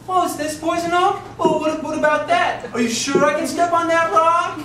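imitating a jittery friend fretting on the trail; mocking a scared friend's worried questions; nervous, tentative. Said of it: "Donnie was just so tentative".

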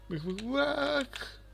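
very high, slow hen cluck. Pretend. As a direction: cluck like a chicken at the bird. Mocks a bird clucking. (tavuk gibi çok ince, yavaş gıdaklama)

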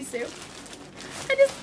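ordinary talk

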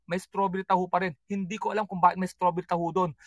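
ordinary delivery

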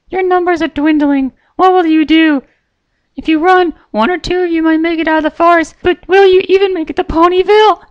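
very high pony voice, taunting; sweetly threatening; Pinkie Pie, menacing. A narrator's reading.

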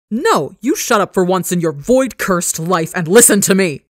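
loud furious roar at full force. Acted. Son finally roaring back at his father, furious. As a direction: roar him down. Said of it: "He roars".

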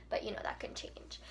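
secretive whispered aside; conspiratorial hushed aside to viewers. Real, not acted. Aside about buying the book.

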